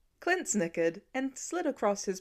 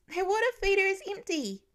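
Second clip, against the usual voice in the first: put-on high child's voice, anxious; worried little boy; 10 semitones higher than usual. A narrator's reading. Little boy telling his dad the chickens lack water, high and anxious. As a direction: tell it like a fretting small kid.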